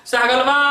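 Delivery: "सहगलवा" with the last vowel held very long; drawn-out call. Shown as a demonstration. Teacher calling a name to show triple-length vowel, drawn-out call. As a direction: stretch the final "वा" of "सहगलवा" as if calling out. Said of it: "अब देखिए कितना खींच रहा हूं मैं".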